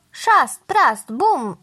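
punchy, high-pitched crash words; sound-effect words. A narrator's reading. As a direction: punch each word like a crash. Narrator voices crow's swooping arrival.

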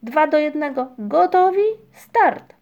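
loud countdown climbing in pitch; referee's call. A narrator's reading. Ryjek referees the cloud game, calling the start. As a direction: build up to a high starting shout. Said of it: "który sędziował".

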